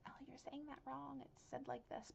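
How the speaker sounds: small, high, soft voice; imagined corrector. Acted. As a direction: pipe up softly as someone correcting. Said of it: "if somebody says".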